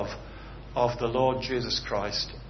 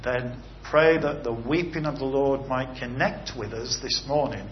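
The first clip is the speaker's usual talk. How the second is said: ordinary talk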